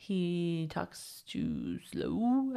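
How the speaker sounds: very slow, drawn-out actor impression; badly faked drawl, stretching every word; Matthew McConaughey. Host attempts the actor's voice, failed slow impression. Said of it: "I can't do a Matthew McConaughey impression".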